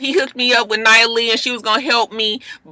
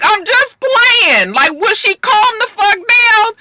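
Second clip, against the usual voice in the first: mock innocent; 11 semitones higher, slower than usual. Sydney brushing off Nia's anger, high mock innocence. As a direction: feign innocence in a high sing-song. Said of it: "Sydney was like".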